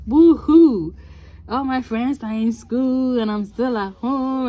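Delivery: theatrical boo-hoo self-pity, half laughing at herself; mock self-pity whine. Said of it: "poor me".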